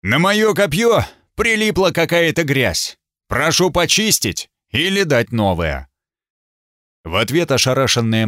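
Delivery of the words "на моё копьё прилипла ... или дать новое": loud. Acted. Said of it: "Я сказал громко".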